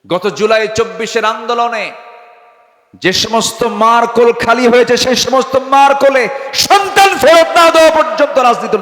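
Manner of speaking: high, loud sermon cry building in force; impassioned preaching. Real, not acted. Preacher demands justice for July's bereaved mothers, impassioned shout. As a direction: thunder the demand at full voice.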